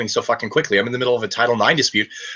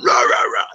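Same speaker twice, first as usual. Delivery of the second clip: mock ranting noise; 3 dB louder than usual. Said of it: "She's like".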